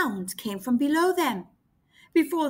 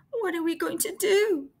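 mild trembling; 5 semitones higher than usual. Acted. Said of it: "asked Gasper, trembling".

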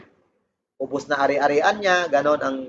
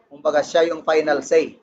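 normal talking voice

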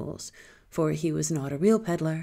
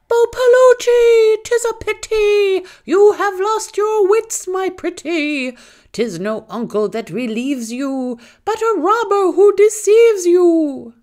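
much higher and louder, slow shrieking bird; screaming peacock; 12 dB louder, slower than usual. A narrator's reading. Peacock screaming its warning at the maiden, high and loud. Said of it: "began to scream".

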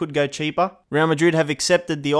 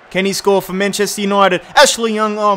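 much louder, higher yell of the name; an earsplitting shout as the shot goes in; real shout; 4 semitones higher, 5 dB louder than usual. Real, not acted. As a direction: yell at the free kick. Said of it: "Sorry if your headphones have broken".

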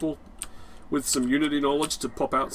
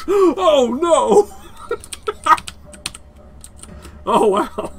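high, loud laughing cry; 12 semitones higher, 8 dB louder, much slower than usual. Real, not acted. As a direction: cry out through real laughter. Cracks up at a mishap.

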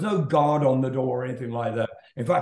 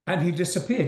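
ordinary delivery

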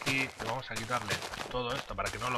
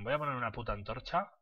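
usual speaking voice

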